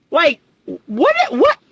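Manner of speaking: high, incredulous what, breaking into a laugh; can't believe what she's hearing; a high squeak of disbelief then a laugh; stunned disbelief. Real, not acted. Disbelief at educated people swearing.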